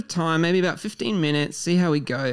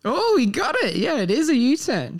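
a bright, excited "he got it"; thrilled the guest guessed the slang right, high and happy; delighted surprise; 9 semitones higher than usual. Real, not acted. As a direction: pitch jumps high, pleased he got it.

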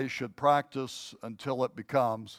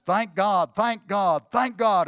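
each call higher and louder than the last; building excited shout; 6 semitones higher, 6 dB louder than usual. Retold or imitated. Confident rider spurs the horse on, building to a shout. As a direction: ramp it up, shouting the horse into a gallop.

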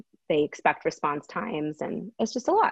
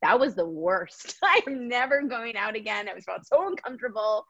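imitating husband; 5 semitones higher than usual. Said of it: "He's like".

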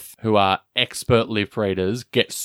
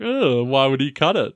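mock-coy retort; cheeky. Retelling Joan's answer to her questioners, lightly cheeky. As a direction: toss it off cheekily, imitating her. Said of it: "she is a little bit cheeky".

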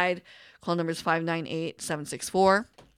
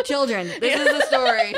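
laughing; 5 semitones higher, 5 dB louder than usual. Real, not acted. Laughing mock moral.

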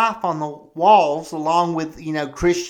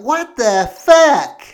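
stunned outrage; 5 semitones higher, 7 dB louder, slower than usual. Retold or imitated. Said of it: "And I'd be like".

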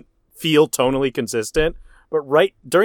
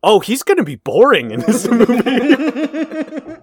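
higher, slow, put-on bored voice; mock bored voice; 8 semitones higher, slower than usual. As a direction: drag it out like you're already bored by him. Predicting Mike Myers will be boring.

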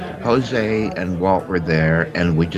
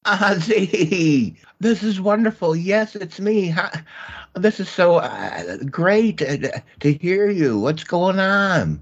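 much higher, delighted voice; thrilled phone greeting, pitched way up; excited; 11 semitones higher, slower than usual. Acted. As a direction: gush with joy at hearing an old friend. Delighted call from old friend.

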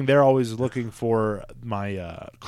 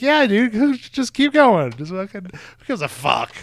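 dismissive shrug-off; 9 semitones higher, 5 dB louder than usual. Retold or imitated. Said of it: "And I'm like".

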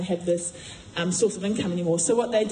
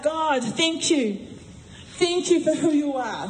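higher, slow, exultant thanks; joyful praise; 6 semitones higher, slower than usual. Retold or imitated. Prisoners thanking God, high and exultant. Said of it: "singing a robust hymn".

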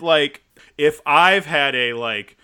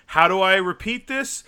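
ordinary talk

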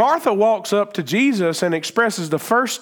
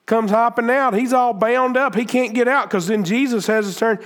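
ordinary talk

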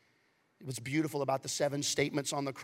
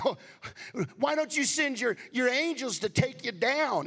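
mocking soldier; 7 semitones higher, 3 dB louder than usual. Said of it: "every soldier that said".

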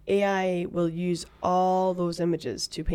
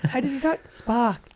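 put-on Welsh accent; 5 semitones higher than usual. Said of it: "a first attempt at a Welsh accent".

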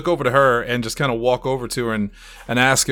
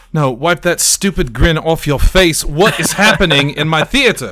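a louder, scolding showman snapping at his assistant; angry magician; 4 semitones higher, 7 dB louder than usual. Acted. Magician scolds his grinning assistant, angry and loud. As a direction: tear into her for grinning. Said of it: "he would say".

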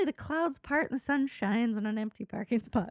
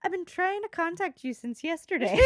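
shaky attempt at an Irish accent, higher, breaking into laughter; badly faked Irish accent for a punchline; 6 semitones higher than usual. Doctor delivers the bad news, uncertain Irish accent. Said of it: "I don't know what this accent is".

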